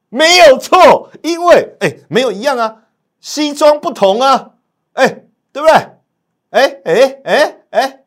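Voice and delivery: crowing over a winning call; giddy triumphant shouting; overexcited. Real, not acted. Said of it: "今天太兴奋了".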